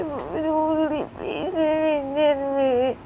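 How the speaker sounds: slow, high tearful whimper; hurt girl showing her finger; crying child. Acted. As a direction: whimper it slowly. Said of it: "बोलती".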